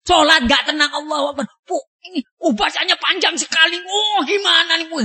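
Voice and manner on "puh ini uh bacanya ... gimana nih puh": mock-exasperated huffing, higher pitched; a hammed-up complaint about a long recitation; impatient grumbling worshipper. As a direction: huff and puff like a fidgety worshipper who wants the prayer over. Impatient worshipper during long prayer.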